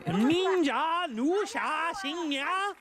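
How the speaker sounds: high, very slow Mandarin attempt; mock Chinese. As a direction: stretch each syllable up high like a learner. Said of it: "Nailed it".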